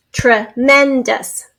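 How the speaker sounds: Tremendous slowly, as TRE, MEN, DOUS; slow syllable-by-syllable demo. Shown as a demonstration. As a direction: say Tremendous in three separate beats. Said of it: "Now say it in syllables".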